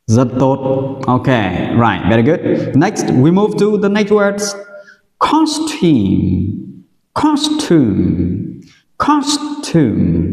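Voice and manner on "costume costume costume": COS in "costume" stressed, long "oo" after it; stress on first syllable. Shown as a demonstration. Teacher models "costume" for learners, first-syllable stress. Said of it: "trọng âm rơi vào âm tiết thứ nhất".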